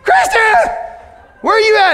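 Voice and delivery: put-on high, loud frantic yell for a lost child; panicked calling out. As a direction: shout across the park for him. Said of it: "you start screaming".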